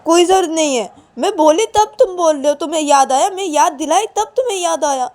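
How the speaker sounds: high, loud indignant snap; angry retort. Acted. Payal, hurt and angry, rebukes Ananya for only remembering when told. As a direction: throw his late reminder back at him. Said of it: "तो पायल बोलती कि".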